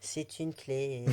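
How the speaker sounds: slow, drawn-out playful influencer reveal; mock influencer. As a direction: present it like an influencer unboxing. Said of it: "Je me sens influenceuse, là, tu vois".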